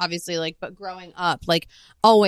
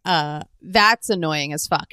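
normal talking voice

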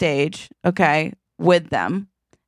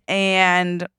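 usual speaking voice

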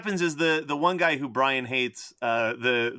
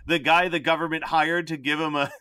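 ordinary talk

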